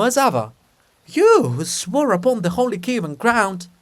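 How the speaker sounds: high, loud, scolding; reproachful outburst. Acted. Olha scolding Andrew for his broken oath, loud and high.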